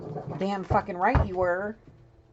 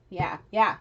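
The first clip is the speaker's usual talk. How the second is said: ordinary talk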